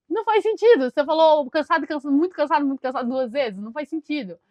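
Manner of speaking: mock-baffled protest, settling as it goes; retold bafflement. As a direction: imitate baffled listeners objecting loudly. Retelling listeners' baffled objection.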